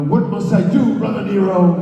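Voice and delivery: high, loud theatrical plea to Brother Nero; hammed-up pleading. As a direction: beg dramatically, as if in a soap opera. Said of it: "And then I'm going like".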